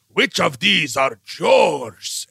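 put-on slow, drawn-out accented villain; theatrical interrogator. Villain showing him the items, theatrical accent. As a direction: relish each word, very slowly. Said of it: "he gives him all these items, like".

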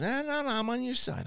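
high, fake-innocent protest dropping away; playing it off. The rat reassures Andor's friend, mock innocent. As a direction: brush off suspicion with mock innocence. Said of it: "playing it off like he does".

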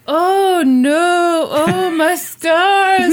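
high, drawn-out fake wail; mock stunned sorrow. Valerie putting on grief for the police, hammed-up wailing. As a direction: ham up the widow's shock, slowly. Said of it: "and stunned and just kinda like".

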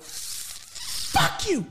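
high, explosive burst of swearing; loud, pissed. Retold or imitated. Kid blurting a swear at his dad, loud and pissed. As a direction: reenact an angry kid's outburst. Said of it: "loud like that".

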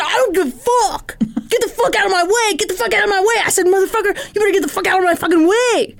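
high-pitched, building angry outburst; hammed-up fury. Gym member threatening the man, mock enraged. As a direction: act out losing your temper. Acted escalating gym quarrel.

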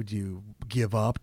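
ordinary talk

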